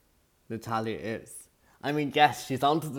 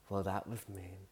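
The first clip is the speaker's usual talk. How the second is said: much softer, deadpan quote as the calm contestant; calm contestant impression; 4 semitones lower, 12 dB quieter than usual. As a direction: say it quietly, unbothered. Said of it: "And then she's like".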